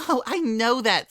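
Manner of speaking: high, fast, breathless chatter; excited Pinkie. Acted. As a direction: gush it out in one excited rush.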